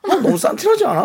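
high, sneering gossip whisper; catty gossip. Retold or imitated. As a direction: snipe like a catty friend. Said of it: "뭐 이런 식의 약간 험담하죠". (비꼬는 험담 말투)

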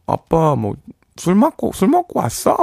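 put-on high little kid asking dad; child voice. Child voice questioning drunk dad.

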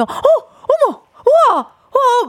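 high, slow amazed gasps; excited gasps. Retold or imitated. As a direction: gush with wide-eyed wonder. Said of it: "막 이런 것도 없이".

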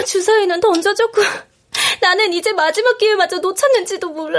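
usual speaking voice

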